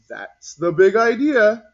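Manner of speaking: loud, slow, booming cat teacher; teacher character voice. Acted. Mister Whiskers telling the class, loud and slow. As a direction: boom it out like a proud teacher.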